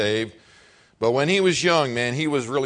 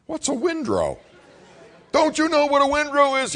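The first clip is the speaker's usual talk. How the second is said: much higher mocking scold; hammed-up father scolding; imitating scolding dad. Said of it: "And I'm like".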